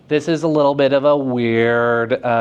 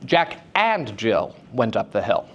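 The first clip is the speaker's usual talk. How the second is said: "and" stretched and set apart in "Jack and Jill"; stress on and; 3 semitones lower than usual. Shown as a demonstration. Teacher testing how meaning shifts, stress on "and". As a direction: lean hard on the word "and". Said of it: "What does that sentence mean".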